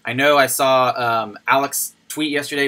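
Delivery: usual speaking voice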